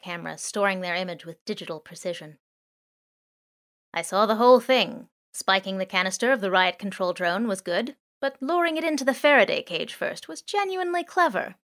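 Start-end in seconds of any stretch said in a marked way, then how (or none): 3.9-11.6 louder, higher drone voice, impressed; surveillance drone, amused. Acted. Drone to the thieves, impressed and teasing. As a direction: praise their trick with a showy lilt.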